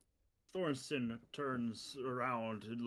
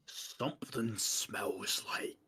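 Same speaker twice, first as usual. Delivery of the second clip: sneering whisper; much slower than usual. Acted. Bandit sneering line in whisper.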